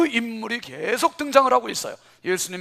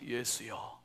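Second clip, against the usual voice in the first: soft call; 10 semitones lower, 15 dB quieter, much slower than usual. Shown as a demonstration. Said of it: "한번 따라합시다".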